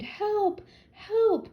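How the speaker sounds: high, loud panicked cries; cries for help. A narrator's reading. Grandmother screaming for help at the wolf, panicked high cries.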